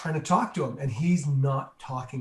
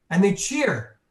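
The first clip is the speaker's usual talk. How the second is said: normal talking voice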